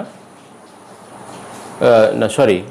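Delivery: ordinary talk, nothing marked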